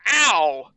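loud, sudden "ow"; pained yelp. A narrator's reading. Girl cutting her finger on a can, loud yelp. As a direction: yelp it out in pain.

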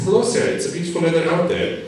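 high, fast, parroted greeting; repeating on a loop. Retold or imitated. Friend repeating his sentence on the plane, parroting. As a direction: rattle it off again and again. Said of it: "he was repeating that sentence".

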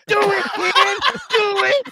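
high, laughing war cry; mock battle shout. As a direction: egg him on like a soldier. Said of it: "Charlie McAdoo".

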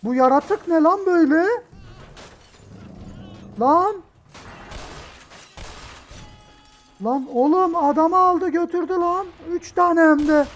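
loud, high-pitched alarmed outbursts; panicked shouting. Real, not acted. Player watching a creature carry a man off, shouting. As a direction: keep yelling in real shock. Monster snatches a man in game.

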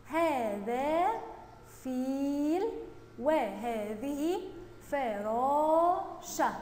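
very slow, word by word; slow read-along. Shown as a demonstration. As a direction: stretch every long vowel for pupils to join. Teacher leads pupils reading together. (قراءة جماعية بطيئة ممدودة)

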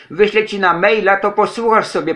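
ordinary talk, nothing marked

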